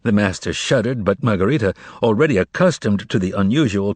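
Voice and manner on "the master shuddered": usual speaking voice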